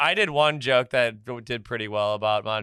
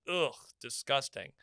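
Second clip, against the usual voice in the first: disdainful; 11 dB quieter than usual. Retold or imitated. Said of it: "French people are like".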